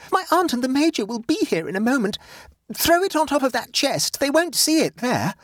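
high-pitched, panicky instructions; frantic. Acted. Nora warns that her aunt is coming, frantic. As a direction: urge him frantically, voice pitched right up. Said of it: "said Nora frantically".

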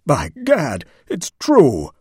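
loud, high astonished cry; excited. Acted. Sir Wilfrid bursting back into the room, excited. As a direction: burst out in shock. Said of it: "eyes dilated with excitement".

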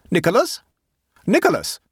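high, loud calling of a name; screaming his name. A narrator's reading. Aunt searching the garden, screaming for Nicholas. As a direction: shout the boy's name across the garden. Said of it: "she screamed".

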